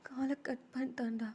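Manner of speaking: acted tearful despair; weepy, helpless voice; crying. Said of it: "எதுக்கு அழுகுற".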